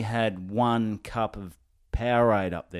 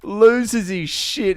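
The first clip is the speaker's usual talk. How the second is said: laughing; 11 semitones higher, 6 dB louder, much slower than usual. Real, not acted. Narrating through laughter.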